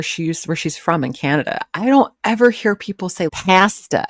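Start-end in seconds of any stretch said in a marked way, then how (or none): 3.3-4.0 loud, high "pasta" as PASS-tuh; mock "pasta" with a flat short A; "pasta" with the A of cat, pushed louder; deliberate mispronunciation. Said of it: "she likes to mispronounce on purpose".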